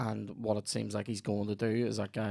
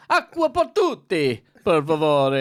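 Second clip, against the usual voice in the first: showy Italian; 6 semitones higher, 9 dB louder than usual. Pretend. Showing off to the table, loud hammed-up Italian accent. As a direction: order in Italian to impress the whole table. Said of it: "order water for the whole table in, in fucking Italian".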